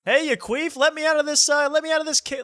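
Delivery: very high, loud squealing woman in a well; screeching high voice, first try; high-pitched shriek. Retold or imitated. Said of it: "I'll be like".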